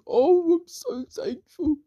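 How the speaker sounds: very high, slow fake weeping; hammed-up grateful wail; mock tearful gratitude. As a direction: squeal thanks as if moved to tears. Character overwhelmed with gratitude.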